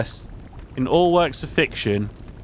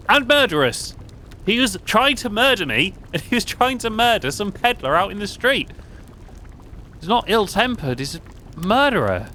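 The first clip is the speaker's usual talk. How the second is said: raised, heated protest; indignant outburst; 8 semitones higher than usual. Real, not acted. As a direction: correct the game, outraged. Outraged at game text.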